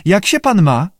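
high-pitched polite lizard; high creature small talk; salamander voice. A narrator's reading. Salamander makes polite conversation.

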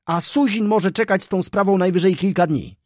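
high, loud, breathless gossip; excited young clerk. Acted. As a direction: rattle it off, scandalised and thrilled. Mraczewski's excited gossiping speech. (podniecony, głośny młody subiekt)

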